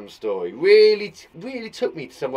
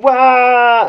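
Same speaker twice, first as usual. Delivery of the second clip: long, loud, high "waah"; play-acted cartoon wail of fright; hammed-up scream; 9 semitones higher, 14 dB louder than usual. Acting scream in scary comic.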